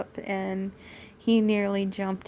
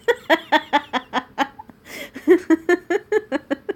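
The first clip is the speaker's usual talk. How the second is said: loud, high cackling laugh; 7 semitones higher, 6 dB louder than usual. Real, not acted. Reader reacting to off-the-charts sarcasm, laughing hard. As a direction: burst into long, staccato laughter.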